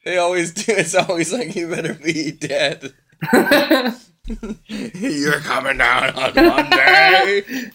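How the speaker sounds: high, loud, laughing through it. Pretend. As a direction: crack up while hyping the ad. Laughing mock announcer riff.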